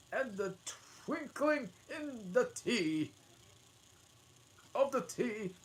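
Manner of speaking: quake through it like a frightened man; trembling voice. Acted. Hatter pleading with the king, high trembling voice. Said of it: "in a trembling voice".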